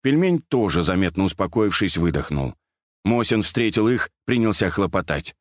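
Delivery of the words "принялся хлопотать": plain reading voice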